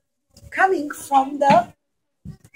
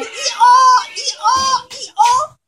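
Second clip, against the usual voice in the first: put-on high, braying hee-haw, drawn out for the kids; donkey bray; 9 semitones higher, much slower than usual. Storyteller to children, imitating the donkey, high braying. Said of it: "the donkey would have gone".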